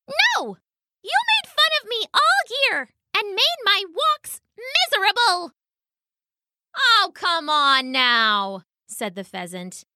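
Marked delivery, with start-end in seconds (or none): 0.1-5.5 tiny, very high-pitched indignant mouse; mouse calling back. A narrator's reading. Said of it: "the mouse called back". 6.8-8.6 slow, drawn-out cajoling, pitched up; dismissive pheasant. Acted. Pheasant brushes off complaint.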